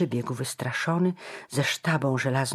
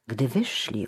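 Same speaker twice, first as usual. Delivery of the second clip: usual speaking voice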